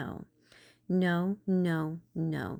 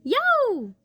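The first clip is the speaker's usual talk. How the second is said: pained yelp; 8 dB louder than usual. Acted. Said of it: "I bumped my knee".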